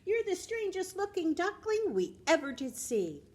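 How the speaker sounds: lightly voiced jeering duckling friends; sing-song teasing from the other ducklings. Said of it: "All his friends kept saying to him".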